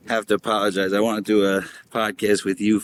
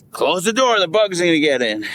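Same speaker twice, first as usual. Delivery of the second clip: quoting mom; 6 semitones higher, 3 dB louder than usual. Retold or imitated. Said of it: "Mom used to always say".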